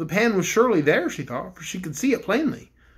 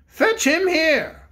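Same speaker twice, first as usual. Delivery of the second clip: slow, higher royal command; the king orders the bears to fetch the pink bear; 9 semitones higher, 4 dB louder, much slower than usual. Acted. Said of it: "commanded the king".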